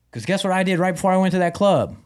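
ordinary talk, nothing marked

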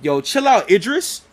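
half-playful telling-off; retold flip-out. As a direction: snap it as a mock challenge, rising on the name. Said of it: "he flipped out straight off the bat".

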